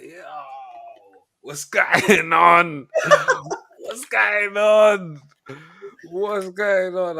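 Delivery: hammed-up excited call; laughing excitement. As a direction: stretch it out, laughing in disbelief. Excited replay of his reaction.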